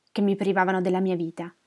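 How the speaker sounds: usual speaking voice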